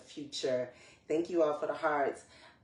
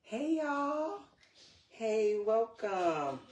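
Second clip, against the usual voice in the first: bright, much higher call to the viewers; high excited hey y'all; excited greeting; 7 semitones higher, slower than usual. Real, not acted. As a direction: greet them with a high happy lift.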